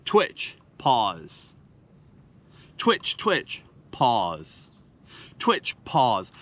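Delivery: twitch and pause cadence. Shown as a demonstration. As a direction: snap up on "twitch", sink low on "pause".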